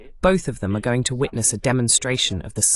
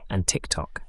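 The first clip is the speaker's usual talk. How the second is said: whispered aside; 4 semitones lower, 7 dB quieter than usual. Acted. Host listing his social networks to viewers, whispering the last one. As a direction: drop to a whisper on the last item.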